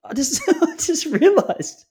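slow, giggling admission; husband laughs as he answers wife; laughing through it. Real, not acted. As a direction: laugh while owning up.

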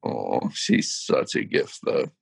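breathless, very low whisper; a slow, awed whisper; moved whisper. Real, not acted. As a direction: let it slip out slowly under your breath, touched. Moved by the singer's story.

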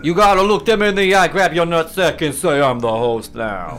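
reservation gambler voice. Pretend. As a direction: play the old guy gambling at the reservation casino. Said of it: "You sounded like the guy gambling at the reservation".

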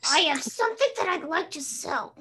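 Marge Simpson impression. Second take of the Marge line for friends. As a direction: another stab at Marge's sales line. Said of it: "now is Marge Simpson".

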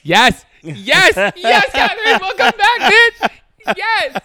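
high, loud hyped welcome; excited shouting. Acted. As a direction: cheer the name out loud.

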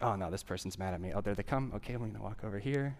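soft, quick worried mutter to yourself; anxious inner voice. Retold or imitated. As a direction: fret under your breath. Imagined anxious avoidance thoughts.

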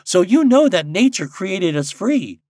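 high, loud bird making a case; grave robin. A narrator's reading. Robin arguing with Popopo for freedom, gravely. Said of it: "said a robin redbreast gravely".

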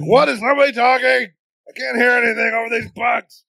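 loud, high shout; shouting over noise. Acted. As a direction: yell over the din. Yelling over bug noise.

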